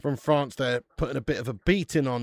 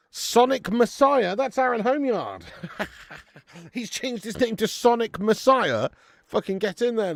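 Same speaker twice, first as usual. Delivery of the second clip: excited greeting; 8 semitones higher, 5 dB louder than usual. Real, not acted. Greeting a viewer's new name.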